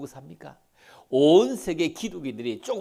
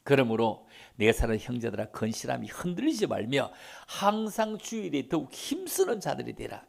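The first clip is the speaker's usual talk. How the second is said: normal talking voice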